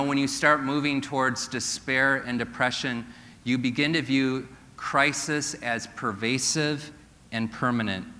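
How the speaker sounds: usual speaking voice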